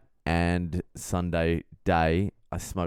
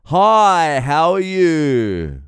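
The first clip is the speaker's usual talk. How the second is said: shouted high greeting at the top of his voice; loud booming guy; 8 semitones higher, 11 dB louder, much slower than usual. Retold or imitated. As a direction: boom it out like the loud guy on the train. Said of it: "He's like".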